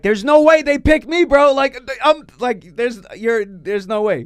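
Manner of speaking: almost yelling in disbelief; excited disbelief. Retold or imitated. Driver telling friends how he cashed it, almost yelling in disbelief.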